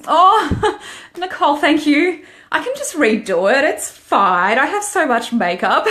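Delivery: high bursts of real laughter; laughing at a sweet comment from Nicole; laughing, touched. Real, not acted. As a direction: laugh it off, flattered and surprised.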